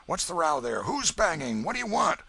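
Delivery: loud, rough call into the night; gruff call from window. Acted. Old Welshman calling down at the door. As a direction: shout down at the banging stranger.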